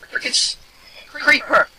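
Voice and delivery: high, loud panicked cry; startled yell. Real, not acted. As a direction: yelp as the creeper appears. Creeper ambush.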